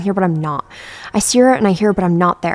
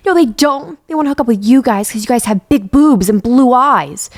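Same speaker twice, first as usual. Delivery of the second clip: wailing; 5 semitones higher, 6 dB louder than usual. Acted. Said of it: "cries turn to full-blown wails".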